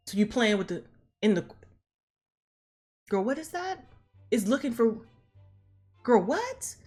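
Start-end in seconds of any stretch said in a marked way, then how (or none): 3.1-6.8 high, rising disbelieving question; acted baffled disbelief; incredulous. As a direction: voice jumps up in mock bafflement. Acting out Janet's disbelief.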